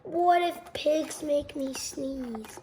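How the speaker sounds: plain reading voice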